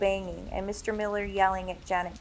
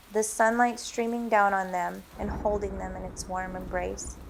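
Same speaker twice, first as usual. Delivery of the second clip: ordinary delivery, nothing marked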